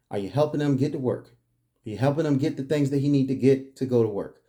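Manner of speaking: ordinary talk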